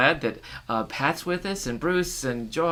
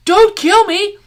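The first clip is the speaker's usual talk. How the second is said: much higher, loud frightened cry; terrified plea; 13 dB louder, slower than usual. Acted. Woman begging the man not to kill her, frightened cry. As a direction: beg for your life.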